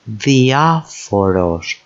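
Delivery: diaphoros as thi-A-fo-ros, drawn out slowly; diaphoros, slow, weight on A; stress third from end. Shown as a demonstration. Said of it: "third syllable emphasized".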